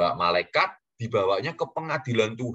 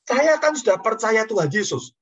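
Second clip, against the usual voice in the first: loud, high voicing of a convert; emphatic declaration; 7 dB louder than usual. Imitating the old man showing his idols, emphatic declaration.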